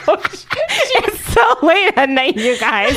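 high, slow, still laughing. Real, not acted. Excusing herself to co-hosts for the late-night flub, laughing. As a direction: spill it out mid-giggle. Laughing fit over pronunciation.

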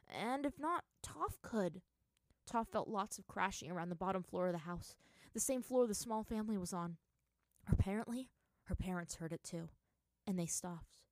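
ordinary delivery

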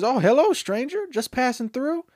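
high-pitched, hearty greeting to a stranger; friendly townsman. Retold or imitated. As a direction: hail a newcomer in a high, put-on voice.

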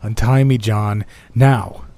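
loud, commanding demand; barked order. Acted. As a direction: snap the order at him. Bound man ordering his captor.

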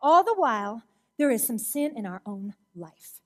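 ordinary talk

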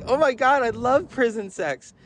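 high, loud fan's exclamation; really loud. Retold or imitated. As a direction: blurt it out excitedly for all to hear. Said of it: "she says really loudly".

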